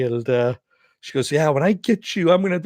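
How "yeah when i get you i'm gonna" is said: raised, louder mock threat; pitched-up quoted threat over the phone; retold threat. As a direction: imitate a threat, pitched up and cut short. Acted threat in retold phone quarrel.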